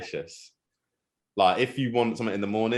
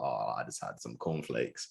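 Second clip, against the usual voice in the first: low, slow, much softer mumble; sheepish player; 9 semitones lower, 12 dB quieter, slower than usual. Retold or imitated. Presenter mimicking a footballer admitting his breakfast, sheepish mumble.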